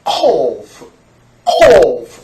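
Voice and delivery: 코프 with a q from deep in the throat; 코프 pushed from far back, not a K; 코프 as a back q sound. Shown as a demonstration. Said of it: "속에서 나야 돼요".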